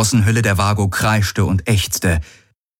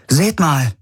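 high-pitched exclamation; sudden raised-pitch call-out; 8 semitones higher than usual. A narrator's reading. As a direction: call the others to look. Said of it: "rief Kirai aus".

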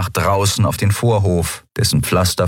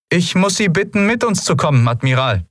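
much higher, formal officer's voice; female lieutenant voice; 12 semitones higher than usual. Acted. Lieutenant announcing herself to the admiral, in a high formal officer voice.

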